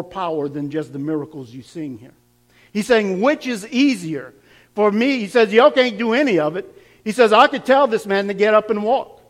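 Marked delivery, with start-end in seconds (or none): 2.7-9.2 preaching. Acted. Pastor preaches Jesus' challenge.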